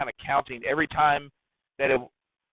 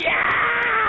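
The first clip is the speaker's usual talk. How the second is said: high, excited yell of victory; crowd cheer; 4 dB louder than usual. Retold or imitated. The crowd cheering the jackpot, a high excited yell. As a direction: cheer like the whole crowd just won.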